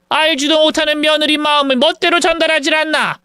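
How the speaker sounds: indignant mother demanding to see her daughter-in-law; angry mother. Retold or imitated.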